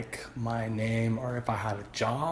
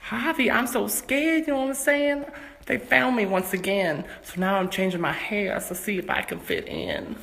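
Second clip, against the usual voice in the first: high, frightened dame voice; scared dame; 8 semitones higher than usual. Acted.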